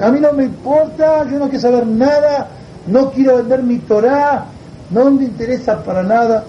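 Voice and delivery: high, insistent wail; tearful refusal. Acted. Yossi rejects the signed agreement before his rabbi, high wailing refusal.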